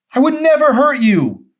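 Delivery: very high, very loud shout; husband screams his denial at his wife; anguished scream. Acted. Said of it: "he screamed".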